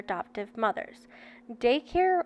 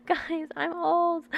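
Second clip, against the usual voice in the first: breathy laughing cry then higher squeal; mock despair; 7 semitones higher, slower than usual. Real, not acted. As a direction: laugh it out, pretending to be horrified. Laughing at own age.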